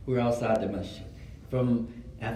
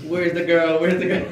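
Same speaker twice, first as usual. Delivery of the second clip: loud, higher, urgent calling; mock frantic search; 4 semitones higher, 7 dB louder than usual. Trying to find her in yellow pages, mock frantic. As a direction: act out a comic hunt, voice raised.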